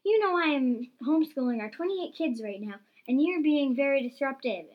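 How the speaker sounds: exasperated wife's complaint; annoyed scolding. A narrator's reading. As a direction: scold him for the disruption. Wife scolds husband over noise.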